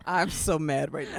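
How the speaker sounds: laughing. Real, not acted. Laughing at the gaslight joke.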